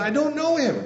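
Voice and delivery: high and loud, swearing it off; cursing denial. Acted. Peter cursing before the crowd, a high loud furious denial. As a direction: spit it out with curses and oaths. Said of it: "with curses and oaths, he said".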